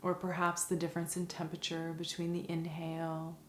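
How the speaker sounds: soft, calm meditation voice with long pauses; guided meditation. Acted. Teacher guiding listeners through a five senses meditation, soft and calm.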